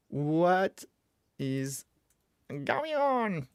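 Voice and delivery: drawn-out words leaping high at the end; spaced-out, exaggerated puzzlement; playful bafflement. Real, not acted. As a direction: climb to a high, incredulous finish. Baffled when his code fails.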